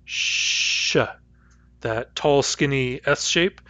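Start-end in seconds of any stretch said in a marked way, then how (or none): 0.0-1.0 sh as a voiceless hush, further back than s; sh sound demo. Shown as a demonstration. Teacher isolating sh for the class, voiceless hush. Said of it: "The next sound is sh".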